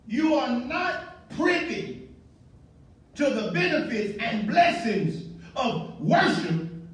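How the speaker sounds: drawn-out, deliberate words; slow emphasis. Real, not acted. Preacher telling the congregation, slow and firm. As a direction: spell it out slowly. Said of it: "preacher stresses the point".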